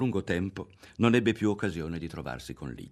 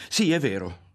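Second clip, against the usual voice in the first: jumps out higher and louder; startled insistence; 6 semitones higher, 6 dB louder than usual. A narrator's reading. Ario to Lidia, startled, cutting her off. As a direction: blurt it with a start. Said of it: "sussultò".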